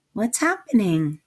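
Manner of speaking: ordinary delivery, nothing marked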